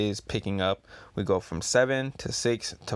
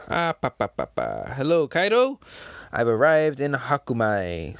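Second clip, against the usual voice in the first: comic character voice; 2 semitones higher, 3 dB louder than usual. Acted. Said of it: "It says".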